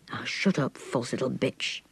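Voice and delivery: low, slow, contained anger; growing angry without raising the voice; angry man. A narrator's reading. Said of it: "came the man's angry voice".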